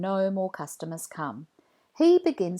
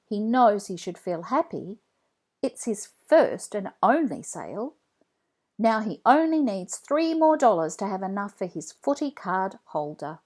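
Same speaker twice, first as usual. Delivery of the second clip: ordinary delivery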